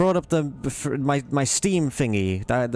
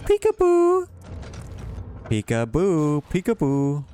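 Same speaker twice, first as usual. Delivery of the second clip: sing-song peekaboo shriek; playful peekaboo; 5 semitones higher than usual. Acted. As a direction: squeal it like teasing a toddler. Teasing an enemy behind door.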